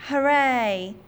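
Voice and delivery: high, loud cheer of relief; joyful cheer. Acted. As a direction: cheer as help arrives. Mowgli cheers his rescuers.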